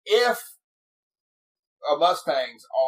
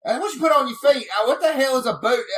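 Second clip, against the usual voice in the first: much higher, louder, hammed-up outraged dad; baffled dad; 12 semitones higher, 7 dB louder than usual. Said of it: "And he goes".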